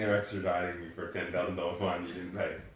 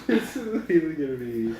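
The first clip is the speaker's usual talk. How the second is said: laughing; 5 semitones higher, 6 dB louder, slower than usual. Real, not acted. Laughing at the recording setup.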